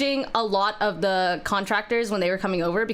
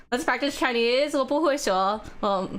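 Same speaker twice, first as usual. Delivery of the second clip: mock ABG accent; 3 semitones lower than usual. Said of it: "Your A B G accent?".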